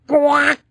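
loud high squawk; high quack. A narrator's reading. Boy answering his mom, high quack. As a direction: squawk like a duck. Silly outburst.

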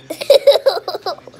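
loud burst of laughter. Real, not acted. Laughing at dog's yawn.

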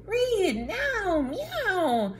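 high, squeaky kitty voice; a cat begging for a story. Acted. Cat character interrupts.

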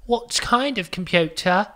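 slow, high mock British accent; Harry Potter. Shop assistant asking about computers, slow fake British accent. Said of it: "talk like Harry Potter".

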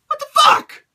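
high, loud outraged yell; outraged shout. Real, not acted. Fan furious at the match finish, loud outraged yell. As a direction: yell it in disbelief.

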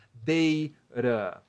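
Deira drawn out in two parts, DEI... ra; R-sound demonstration. Shown as a demonstration. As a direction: stretch Deira slowly to show the tapped R. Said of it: "Dessa forma aqui". (Deira bem devagar, separando DEI e ra)

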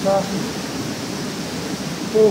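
usual speaking voice

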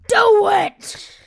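high, loud hype yell; big playful shout; shouted command. Pretend. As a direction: yell the order at the viewers. Urging viewers to press like.

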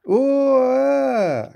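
high, loud shocked groan. A narrator's reading. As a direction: let out a loud startled groan. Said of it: "groaned Percy".

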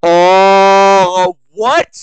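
very loud, high, drawn-out cry of disbelief; outraged yell. Real, not acted. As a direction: yell it out, shocked and indignant. Outraged reaction to the game.

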